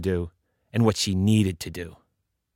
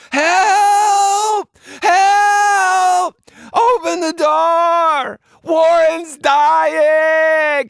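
high, loud, drawn-out cries for help; desperate shout; 8 dB louder, much slower than usual. Acted. Kids begging the teachers to open up, screaming. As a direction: wail it through a closed door. Kids yelling through the door.

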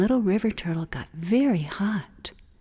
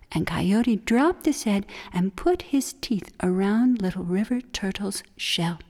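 usual speaking voice

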